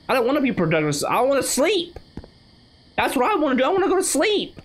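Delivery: high-pitched, whiny complaint; whiny protest. Real, not acted. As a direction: protest in a high, rising whine. Mock tantrum about wanting sleep.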